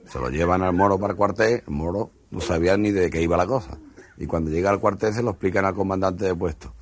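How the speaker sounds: ordinary talk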